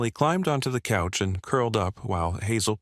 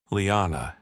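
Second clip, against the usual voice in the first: plain reading voice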